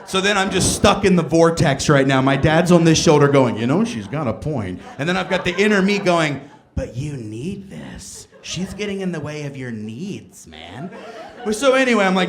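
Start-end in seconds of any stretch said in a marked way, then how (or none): 3.6-4.8 low dad voice agreeing; gruff dad. Retold or imitated. Dad voice on shoulder. 6.7-10.9 low, soft, slow temptation; tempting inner voice. Retold or imitated.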